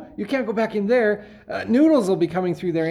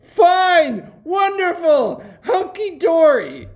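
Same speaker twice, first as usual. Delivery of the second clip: frantic forced cheer; 8 semitones higher, 4 dB louder, slower than usual. Acted. Panicked father covering.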